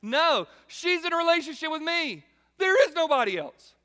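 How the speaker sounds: mock jealous husband; possessive husband. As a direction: insist she's taken. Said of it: "I'm saying, like".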